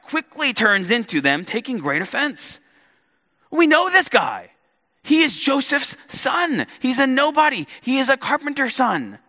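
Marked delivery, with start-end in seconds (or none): 3.5-9.2 high, louder dismissive townsfolk; scoffing crowd. Retold or imitated. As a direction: belittle him as if you knew him as a boy. Preacher voicing offended hometown crowd.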